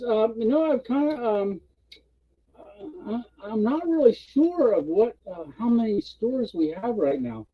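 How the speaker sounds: high, halting stammer; acted uncertainty, feeling for words; hesitant employee. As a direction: stall with ums, imitating an unsure employee. Employee admits losing track of stores.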